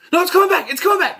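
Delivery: sudden high, loud panic; mock alarmed yelling. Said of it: "They start yelling".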